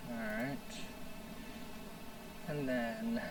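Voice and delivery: pillow talk voice. Real, not acted. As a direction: talk softly like a sleepy pillow chat. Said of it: "pillow talk voice".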